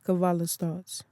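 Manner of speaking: ordinary delivery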